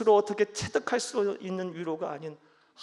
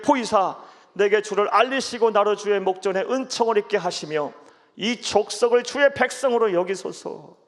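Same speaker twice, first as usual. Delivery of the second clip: scripture reading; 9 dB louder than usual. Acted. Preacher reading Exodus to the church, loud and solemn. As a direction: read the verses out like a proclamation.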